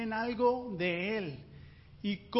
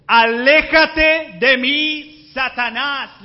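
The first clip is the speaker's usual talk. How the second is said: loud, slow, furious rebuke with a high pitch; stern rebuke; 6 semitones higher, 16 dB louder, much slower than usual. Acted. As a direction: thunder the rebuke at Pedro. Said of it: "reprendió a Pedro". (reprensión furiosa, fuerte y lenta)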